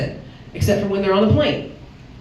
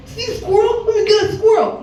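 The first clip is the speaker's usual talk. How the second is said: put-on excited little kid; 12 semitones higher than usual. A little boy begging to pet the squirrel, high and eager. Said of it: "He's like".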